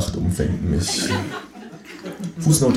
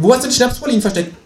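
high, rapid kangaroo voice; kangaroo interrogating; 4 dB louder, much faster than usual. A narrator's reading. Kangaroo demanding chocolates from narrator, high and fast. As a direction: fire the question as a talking kangaroo. Kangaroo repeats, ignoring the reply.

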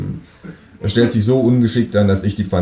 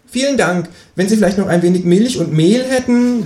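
high-pitched kangaroo neighbour; kangaroo character; 12 semitones higher than usual. Acted. Kangaroo asks the new neighbour for eggs, high-voiced. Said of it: "sagt das Känguru".

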